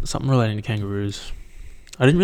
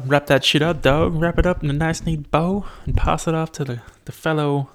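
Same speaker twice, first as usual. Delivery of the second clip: silly put-on voice; 6 semitones higher than usual. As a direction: ham up the sign-off. Playful sign-off voice.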